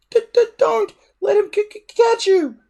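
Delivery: very high, squeaky stutter; a little dragon stammering out a boast; tiny stammering dragon. A narrator's reading. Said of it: "advised Toothless".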